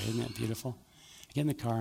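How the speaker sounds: ordinary delivery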